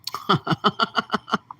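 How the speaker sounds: a real burst of laughter at the host's words; amused laugh. Real, not acted. Co-host laughing at the host's remark, real amused laugh. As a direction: laugh it off, genuinely tickled.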